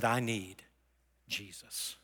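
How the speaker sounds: low and soft, sinking away at the end; hushed retold confession. Rich man walks forward admitting his need, retold low and hushed. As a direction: let the last word drop almost to nothing.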